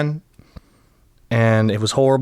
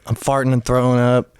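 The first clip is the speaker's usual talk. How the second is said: usual speaking voice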